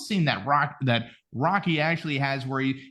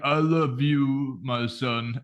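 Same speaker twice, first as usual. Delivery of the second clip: slow, dazed Rocky voice; slow, slurring a tender line; punch-drunk Rocky; 3 semitones lower, much slower than usual. Retold or imitated. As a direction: drag it out like he's brain damaged. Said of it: "he's like".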